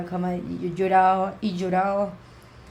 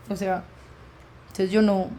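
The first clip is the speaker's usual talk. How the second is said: ordinary talk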